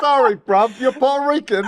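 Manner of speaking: hammed-up London accent, high and loud; mock British accent. Mocking the brother's British accent to the host. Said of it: "he's got that little weird accent".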